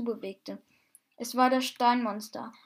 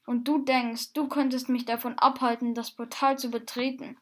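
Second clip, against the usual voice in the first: ordinary delivery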